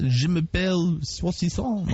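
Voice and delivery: mock French, slow and drawn out; French accent. Host showing off schoolboy French to a caller, French accent. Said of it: "I love your French accent".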